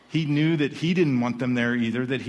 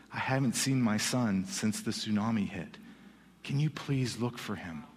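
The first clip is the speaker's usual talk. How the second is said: low, soft retelling of a father's plea; subdued plea; 4 semitones lower, 6 dB quieter than usual. Retold or imitated. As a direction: quote the father's plea softly and lower. Imam pleads for missing son.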